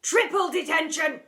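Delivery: high, loud, frantic shouting; escalating rant. Acted. Deputy head ranting punishments.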